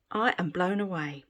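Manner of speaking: usual speaking voice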